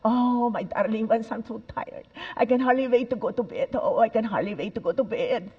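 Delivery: put-on light European accent, v for w, weary. Speaker showing European accents to audience, lightly accented tired sigh. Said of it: "accents are beautiful because European".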